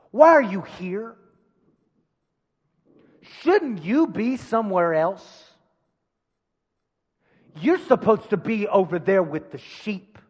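emphatic, accusing put-down; scolding in forceful bursts with long pauses; speaking down. Acted. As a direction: talk down to an unwelcome younger brother. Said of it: "The distracting voice of defeat always speaks down to you".

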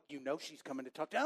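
low, soft, quick inner voice; acted knowing retort. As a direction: answer yourself fast, dropped low and soft. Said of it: "I'm having this internal dialogue".

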